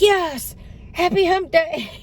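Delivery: high, delighted echo of the greeting; a commenter wishes her happy hump day, she echoes it excitedly; excited reply. Retold or imitated. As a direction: answer the greeting with giddy glee. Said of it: "And I'm like".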